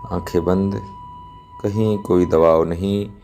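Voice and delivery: ordinary talk, nothing marked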